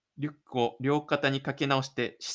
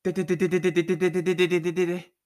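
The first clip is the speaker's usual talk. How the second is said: fast chattering de-de-de; rapid running patter; 2 semitones higher, 3 dB louder, much faster than usual. A narrator's reading. Narrator voicing Tomohiro running out of school, rapid patter. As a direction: rattle it off like pounding feet.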